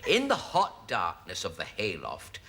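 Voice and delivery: low, measured novel reading; story narration. Acted. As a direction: read it as overblown rustic romance. Reads the novel aloud.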